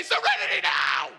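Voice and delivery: hammed-up scream, very high and loud; totally lost it. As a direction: scream the calming phrase in comic rage. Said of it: "I've totally lost it".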